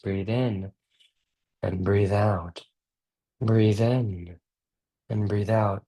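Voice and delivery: slow, hushed British calm; lightly put-on British soothing tone. Shown as a demonstration. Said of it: "a soothing voice like this".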